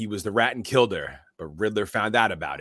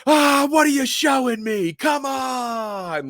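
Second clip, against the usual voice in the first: much higher, louder, slow exasperated mobster yell; Penguin yelling; 6 dB louder, much slower than usual. Retold or imitated. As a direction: shout it like a rattled gangster. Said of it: "he's going".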